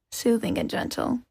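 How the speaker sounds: ordinary delivery, nothing marked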